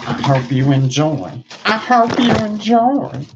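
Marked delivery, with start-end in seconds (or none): 1.6-3.2 much higher, louder showy sign-off; high-pitched mock presenter; high put-on voice. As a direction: repeat it in a high, loud silly voice.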